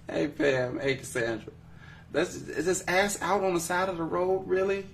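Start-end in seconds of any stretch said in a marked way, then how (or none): 2.1-4.9 raised-pitch disbelief; incredulous. Real, not acted. Host questioning the roadside comment, incredulous. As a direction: repeat it back high, as if you can't believe it.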